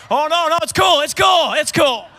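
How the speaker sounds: high, loud flustered reassurance; panicked backpedalling. Acted. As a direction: hastily take it back in a comic panic. Panicked backpedal at airport security.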